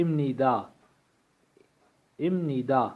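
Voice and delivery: "ام ندا" with m before the n; nasalised ending. Shown as a demonstration. Teacher modelling the ending for learners, "ام ندا" said with m. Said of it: "یہ اس طرح ادا کیجیے گا".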